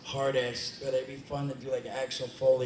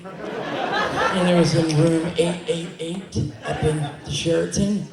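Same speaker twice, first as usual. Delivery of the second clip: words pushed out through real laughter, louder; laughing while telling it; 4 semitones higher, 9 dB louder, slower than usual. Real, not acted. Fan panel guest cracking up at his own story, laughing. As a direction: laugh your way through the hotel room detail.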